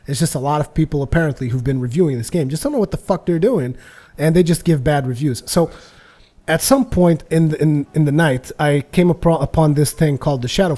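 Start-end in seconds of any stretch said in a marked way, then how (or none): none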